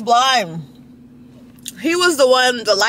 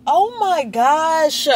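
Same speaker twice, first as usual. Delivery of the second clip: drawn-out surprise; 5 semitones higher, much slower than usual. Real, not acted. Spots rich customer.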